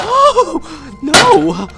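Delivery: high, loud, crying wails of dismay; sobbing shrieks over the spilled mess; tearful outburst. Acted. Caretaker wailing at her captive pet over the mess, crying and shouting.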